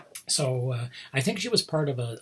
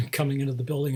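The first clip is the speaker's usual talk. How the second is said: ordinary talk, nothing marked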